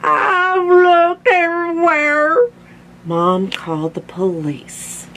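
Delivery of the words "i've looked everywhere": high, slow crying wail; hammed-up weepy old man; tearful Grandpa. A narrator's reading. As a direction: stretch it out as if about to sob. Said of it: "tears in his eyes".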